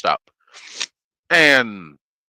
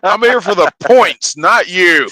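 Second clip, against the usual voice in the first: louder, higher blunt comeback; blunt retort; 5 semitones higher, 9 dB louder than usual. Retold or imitated. Trucker playing himself answering a rude clerk, louder. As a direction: fire back bluntly. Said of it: "retorting to bored clerk".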